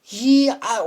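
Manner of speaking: the friend's panicked words, voiced high and loud; high strained quote. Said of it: "He's like".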